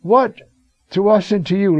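man with unclean spirit crying out in the synagogue. Acted. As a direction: fling the words at Jesus. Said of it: "cries out".

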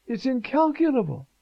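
incalculable lifted high and stretched; emphatic repeat. Acted. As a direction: lean hard on incalculable.

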